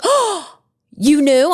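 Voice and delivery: imitating a taken-aback brother; retold surprise. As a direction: mimic his startled disbelief. Acts out brother's surprised reaction.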